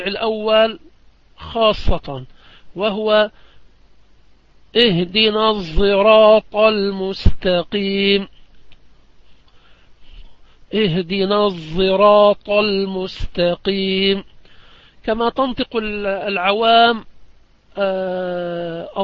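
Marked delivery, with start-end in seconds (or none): 4.8-8.3 الصراط with the sad blended toward a z sound, slow and louder; sad mixed with z. Shown as a demonstration. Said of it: "بالصاد مشمة صوت الزاي". 10.7-14.2 tint the sad in الصراط with a buzzing z; sad mixed with z. Shown as a demonstration. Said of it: "بالصاد مشمة صوت الزاي".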